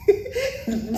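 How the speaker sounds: high, helpless laugh; uncontrollable laugh. Shown as a demonstration. Woman showing how she laughed at the officer, high laughter. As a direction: laugh as if unable to stop. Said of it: "just like this laughing".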